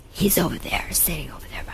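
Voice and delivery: hushed, breathless whisper of a warning; retold whisper. Assistant whispering to her about the man nearby. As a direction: whisper it like pointing someone out secretly. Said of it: "my assistant comes and goes".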